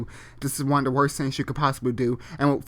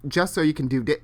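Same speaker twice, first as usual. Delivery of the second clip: usual speaking voice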